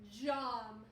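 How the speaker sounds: ordinary talk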